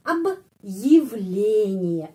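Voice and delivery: Объявление stretched right out, stress on ле; very slow stress demo. Shown as a demonstration. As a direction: say Объявление very slowly, leaning on ле. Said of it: "penekanannya di sini". (Объявление sangat pelan, tekanan pada ле)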